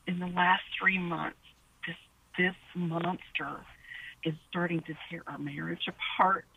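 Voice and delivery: low, slow, breaking into a whisper; barely voicing the words through tears; tearful whisper. Real, not acted. Caller breaks down describing husband.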